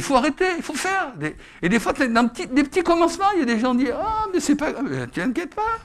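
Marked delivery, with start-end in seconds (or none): 4.0-5.8 mock reassurance. Mimics people brushing it off.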